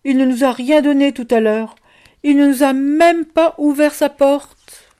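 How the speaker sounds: loud, high-pitched grievance; indignant child. A narrator's reading. Child telling how the door stayed shut, indignant. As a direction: build the outrage like a wronged child. Child complains about being refused.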